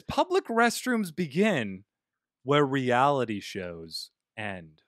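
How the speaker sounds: slow, measured recitation with a long pause; slow quote reading. Shown as a demonstration. Reading inspirational quote to friends, slow and weighty.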